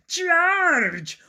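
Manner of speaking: high, loud monster yell; high dinosaur battle cry. A narrator's reading. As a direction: bellow it as a charging dinosaur. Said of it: "a trio of dinosaurs yelling".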